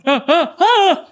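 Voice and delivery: laughing squeaky yelps; embarrassing yelp. Retold or imitated. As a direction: mimic a man's startled yelp. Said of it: "when men yelp like that".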